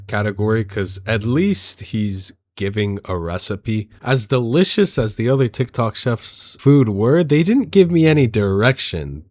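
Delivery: usual speaking voice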